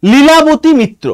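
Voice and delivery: ordinary talk, nothing marked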